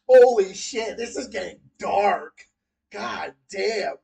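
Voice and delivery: ordinary delivery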